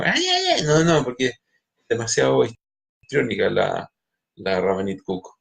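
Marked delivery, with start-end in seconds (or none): 0.0-0.6 high, wailing "ay, ay, ay"; a hammed-up high-pitched lament; mock wailing. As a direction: imitate a histrionic woman crying out. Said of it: "no grita así como".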